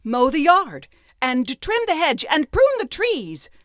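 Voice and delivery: high, loud list of orders, slowing at the end; bossy apprentice. Acted. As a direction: rattle off chores like a proud young master. Apprentice lists chores.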